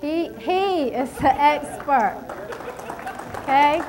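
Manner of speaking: emphatic preaching. Real, not acted. Preacher emphasizing God's skill.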